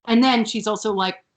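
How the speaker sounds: ordinary talk, nothing marked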